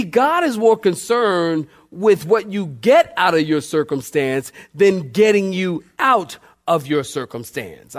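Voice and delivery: usual speaking voice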